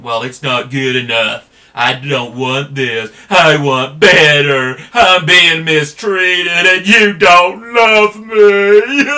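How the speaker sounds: whiny mock tantrum building to a high, loud, slow wail; mock spoiled child tantrum. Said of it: "like a spoiled child, you're throwing a tantrum".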